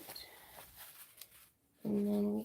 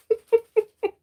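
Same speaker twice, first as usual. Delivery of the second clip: laugh; 13 semitones higher, 7 dB louder than usual. Real, not acted. Laughs after apology.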